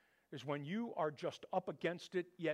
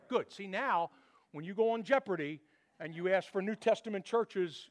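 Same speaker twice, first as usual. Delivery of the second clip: ordinary delivery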